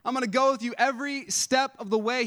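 lightly voiced God promising Moses; God reassuring Moses. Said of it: "God was saying".

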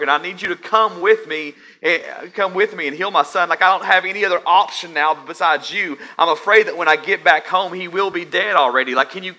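high, loud frantic pleading from a father; desperate father. Acted. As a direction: beg as if every second counts for his dying son. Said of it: "I can just hear the desperation in the father's voice".

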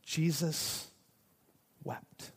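hushed, solemn reading with a long pause; simply. A narrator's reading. Preacher reading the shortest verse to congregation, simply and hushed. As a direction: let it drop almost to nothing. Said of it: "It says simply".

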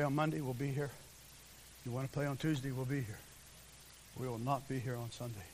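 low, soft, measured refusal; acted calm firmness. Parent telling the coach they will be in church, calmly firm. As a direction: keep it low and quiet, leaving no room for argument.

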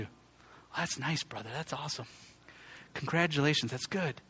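softly muttered lukewarm congratulations; half-hearted nice brother, barely voiced; flat mock politeness. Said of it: "here's what we often do".

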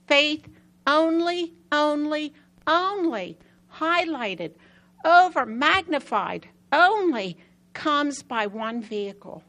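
slow and hit every "only" hard; emphatic preaching. Real, not acted. Preacher drives home faith to the congregation, slow and emphatic.

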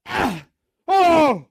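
loud high scream of fright; sudden high "Ah! Oh!"; startled yell. Retold or imitated. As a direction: yelp in shock at what's behind the door. Startled opening the door.

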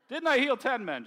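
high, loud corrected line; preacher repeats Jesus' question loudly after a correction. Retold or imitated. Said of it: "Thank you for pointing it out".

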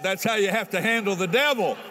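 sermon climax, loud preacher voice; fiery preaching. Acted. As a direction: rouse the congregation. Preaching at full force.

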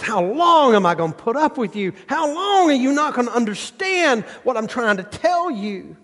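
high, pleading, exasperated; fed-up father asking how long; frustrated father. Acted. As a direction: plead like a worn-out dad. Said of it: "He goes".

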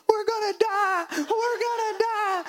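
very high mock wail; hammed-up crying, drawn out; imitating crying kids. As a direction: wail like panicking little children. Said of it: "start crying".

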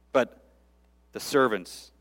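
ordinary talk

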